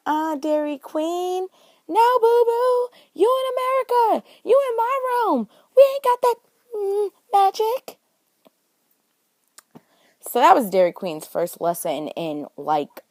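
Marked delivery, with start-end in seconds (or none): 0.1-8.0 sassy scolding. Acted. Telling the Dairy Queen she has no magic here, high loud sassy scolding. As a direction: talk down to her like a sassy local, no boo-boo.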